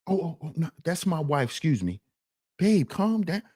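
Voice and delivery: smooth it over under your breath, then calm the wife; softly soothing an angry wife in a restaurant; husband apologizing quietly. Retold or imitated. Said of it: "that's [name] to [name]".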